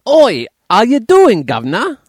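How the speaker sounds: loud, high hammed-up Cockney greeting; bad Cockney accent. Podcast guest greets the host as Eliza Doolittle, bad Cockney accent. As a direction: holler it in a wobbly mock-Cockney. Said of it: "back into Cockney, potentially Australian".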